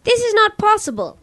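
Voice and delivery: high, protesting little lamb; frightened lamb. A narrator's reading. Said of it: "protested the frightened lamb".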